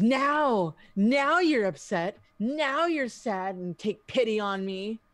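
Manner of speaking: loud, high, drawn-out indignant NOW; acted outraged accusation, easing off toward the end; bitter confrontation. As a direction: throw it in their face, indignant. Eddie confronts Mercy in character.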